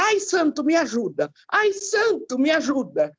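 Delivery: high "ai" cries, dropping on each appeal; imitated desperate plea. Daughter imitating mother after a near crash, pleading in distress. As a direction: act out a mother begging saints for help. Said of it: "ela clamando pelos santos".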